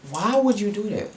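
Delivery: high incredulous whine; exasperated. Retold or imitated. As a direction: ask it stunned and betrayed. Reliving the prank outrage.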